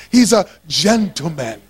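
low, put-on British accent. Shown as a demonstration. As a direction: model a mock-British gentleman for the crowd. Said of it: "say it like I said it".

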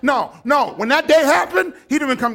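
loud, high sudden preaching; preaching outburst. Acted. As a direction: flare up at the congregation. Preacher flares up mid-point.

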